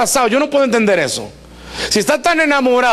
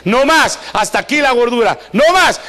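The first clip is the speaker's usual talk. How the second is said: defiant shout; 5 semitones higher than usual. Retold or imitated. Women fed up with weight, shouting a defiant vow. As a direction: declare it fed up. Women declaring end to fat.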